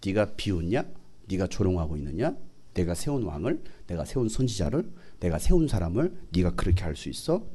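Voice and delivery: low, quiet stern rebuke; a hint of a stern God scolding; God rebuking. God confronting those who mock his prophet, lightly voiced quiet rebuke. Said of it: "하나님은 다 듣고 계시기".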